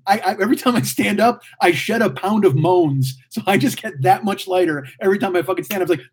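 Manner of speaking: ordinary talk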